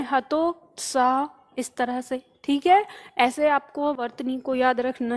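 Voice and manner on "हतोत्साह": हतोत्साह drawn out slowly in two pieces; very slow teacher voice; slow dictation. Shown as a demonstration. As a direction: say it slowly for the notebook. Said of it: "दो टुकड़ों में".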